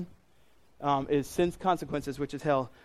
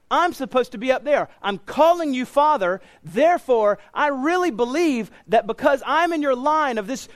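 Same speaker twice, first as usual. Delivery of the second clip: raised, loud, indignant pleading to Father Abraham; presumptuous claimant; 7 semitones higher, 5 dB louder than usual. Acted. Rich man in hell insisting to Abraham, loud indignant pleading. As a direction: insist you belong in heaven, higher and louder.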